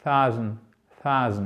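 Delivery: THOUSAND avec TH soufflé, langue entre les dents; TH anglais correct. Shown as a demonstration. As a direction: laisse passer la langue entre les incisives sur le TH de thousand. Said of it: "on la laisse juste passer entre les incisives". (thousand avec un TH subtil, ton descendant)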